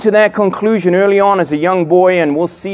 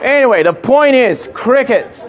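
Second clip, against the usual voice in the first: normal talking voice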